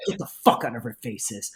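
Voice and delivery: low-pitched, restrained telling-off; low, controlled dismissal; imitated hostile woman. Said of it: "she, like, says it like this".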